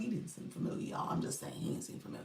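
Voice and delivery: hushed, near-whispered admission; quick, whispery aside; confiding aside. Real, not acted. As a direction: drop to a whisper for the audience.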